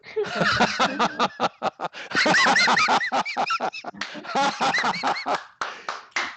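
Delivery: long, loud high belly laugh; big laugh. Real, not acted. Coach after hearing a favorite affirmation, big laugh.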